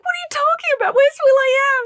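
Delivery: very high, loud protest; hammed-up exasperated shriek; indignant disbelief. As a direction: demand answers in mock outrage. Retells outraged reaction to the changes.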